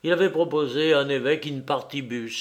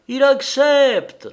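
high, loud, slow announcement; curé crying out his triumph; triumphant cry; 11 semitones higher, 9 dB louder, slower than usual. A narrator's reading. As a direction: burst in waving the news. Curé bursts in with telegram.

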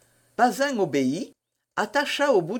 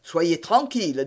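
plain reading voice

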